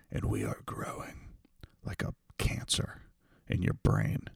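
eerie breathy whisper, soft and low; creepy whisper. Pretend. Podcaster creepily whispering to listeners.

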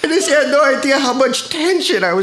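high, cracking-up emotional confession; hammed-up weepy pleading; tearful outpouring. Guest tells host of being held by a man, tearfully.

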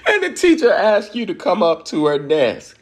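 giggling through the punchline; real laughter on the words; laughing. Real, not acted. Laughing at own embarrassing story.

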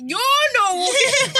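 loud, high, drawn-out contradiction; exasperated mock quote. Friend retelling companion correcting her walk, exasperated. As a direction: imitate him calling her out, slowly.

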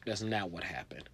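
normal talking voice

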